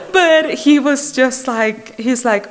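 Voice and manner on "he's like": usual speaking voice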